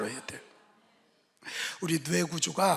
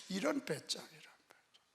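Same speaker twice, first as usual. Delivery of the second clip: whispered scoff; 8 dB quieter than usual. Acted. Preacher's mocking aside.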